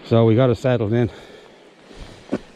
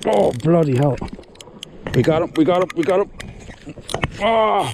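excited shouting; 7 semitones higher, 3 dB louder than usual. Real, not acted. Hooking a big eel, shouting.

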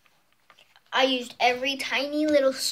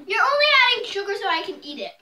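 fast complaint; 8 semitones higher, 5 dB louder, faster than usual. Real, not acted. Complaining about the lemon.

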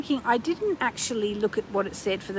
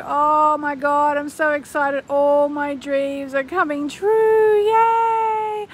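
higher and louder, near-squeal joy; higher, louder, bursting with excitement; thrilled affirmation; 6 semitones higher, 7 dB louder, much slower than usual. Pretend. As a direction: celebrate like it's already happened.

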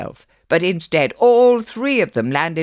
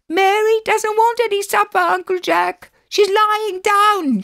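put-on very high, loud child's yell; shrill-pitched holler to another room; 4 dB louder than usual. A narrator's reading. Alice yells down to Uncle Jack. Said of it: "she yelled".

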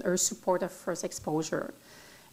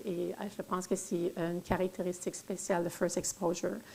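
ordinary delivery

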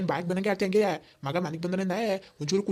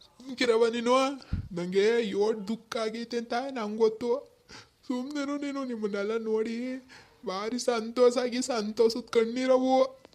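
tearful, slow and higher, tears of joy through the words; ಸಂತೋಷದ ಕಣ್ಣೀರಿನೊಂದಿಗೆ ಅಳುತ್ತಾ; crying with joy; 4 semitones higher, slower than usual. Acted. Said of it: "ಏನ್ ಹಂಗೆ ಅಳ್ಕೊಂಡ್ ಕೂತಾನ ಅಲ್ಲೇ?".

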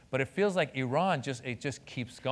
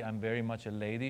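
put-on lower, softer Iranian-accented mother; mom's ladylike Iranian accent; 4 semitones lower, 6 dB quieter than usual. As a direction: imitate a dignified mom, Iranian accent. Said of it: "likes to present herself as a lady".

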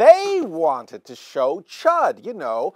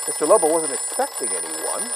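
usual speaking voice

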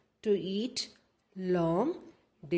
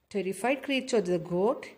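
usual speaking voice

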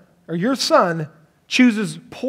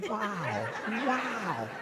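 much softer, hushed wow with a laugh; awed hush; 16 dB quieter than usual. Retold or imitated. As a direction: hush like entering a tense room.

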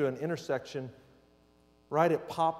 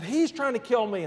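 mild, higher-pitched mock yell; retold scream; 7 semitones higher, 4 dB louder than usual. Frightened woman to the driver at the window, mild mock scream. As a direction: retell her panicked cry without really screaming. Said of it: "she's screaming and hollering".

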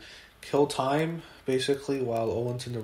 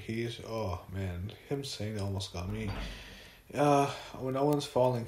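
normal talking voice

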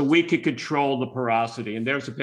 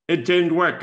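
"It didn't work" louder and higher; emphatic repeat; 4 semitones higher, 6 dB louder than usual. Shown as a demonstration. Admitting the failed bed sheet with a loud "didn't". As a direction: punch "didn't" with a rueful push. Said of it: "repeated admission".

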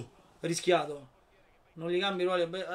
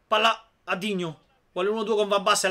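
ordinary delivery

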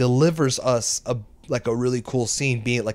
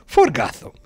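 put-on loud German-accented battle cry; hammed-up German superhero declaration; German-accented Batman; 6 dB louder than usual. Batman defending his city, German accent. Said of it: "but with that accent".

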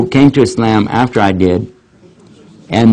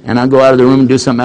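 ordinary talk, nothing marked

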